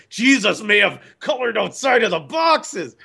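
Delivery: high, exclaimed scandalized protest; mock outrage. Voicing Christians unable to accept it, mock shocked. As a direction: cry out as if appalled by the idea.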